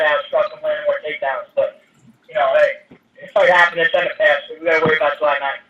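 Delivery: talking through a speakerphone. Real, not acted. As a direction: voice over a phone line. Fighter calling in to conference.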